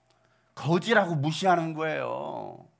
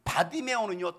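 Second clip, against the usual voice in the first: ordinary delivery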